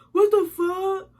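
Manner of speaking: lightly voiced high mock of the boyfriend. Girlfriend imitating boyfriend reading the receipt, light high mock voice. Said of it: "Taking a receipt, looking".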